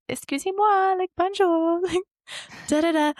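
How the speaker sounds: high, halting attempt at French; foreign accent in French. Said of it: "trying to speak French to them".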